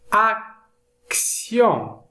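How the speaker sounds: "action" split in two with a gap; slow, broken-up "action"; careful word modelling. Shown as a demonstration. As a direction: sound out "action" slowly, ac, then tion. Split model, then said whole.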